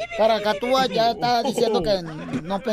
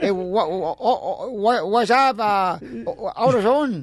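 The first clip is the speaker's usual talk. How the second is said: broken mock English, stammering brand names; jokey fake English, stumbling and laughing; 2 semitones lower, slower than usual. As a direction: babble fake English like a joke gringo. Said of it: "I don't speak English".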